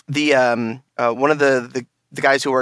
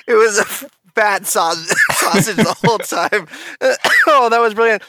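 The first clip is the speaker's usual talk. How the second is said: laughing; 7 semitones higher than usual. Real, not acted. Laughing after intro.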